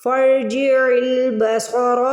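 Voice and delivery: فرجع البصر with fa joined to a heavy ra, very slow; slow Quranic recitation; slow tajweed recitation. Shown as a demonstration. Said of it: "را کو یہاں پہ موٹا پڑھیں گے".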